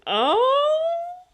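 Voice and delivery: high, loud startled "Oh"; mock surprise. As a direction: yelp it like you're caught off guard. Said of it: "I'm like".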